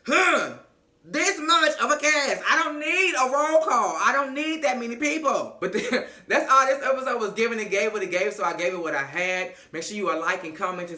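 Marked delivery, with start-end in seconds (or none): 0.1-5.6 loud, high, emphatic complaint; worked-up, fed-up protest; exasperated plea. Real, not acted. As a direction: plead loudly, punching every phrase. Exasperated plea for smaller cast.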